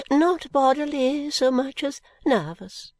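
put-on high, slow fussy old lady; fluttery old lady. Miss Flite explains her illness, high and slow.

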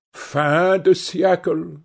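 ordinary delivery

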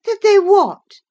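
sharp, high, loud demand; indignant cry. A narrator's reading. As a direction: cry it out high, cutting in on him. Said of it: "cried Catherine".

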